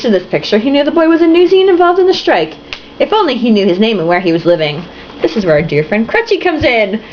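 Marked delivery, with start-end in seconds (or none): none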